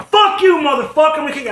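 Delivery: loud, high mock fury; imitated angry rant. Angry guy yells at him in a bar, imitated furious yell. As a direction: imitate a man yelling threats, pitch shooting up. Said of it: "it's hard to be that angry".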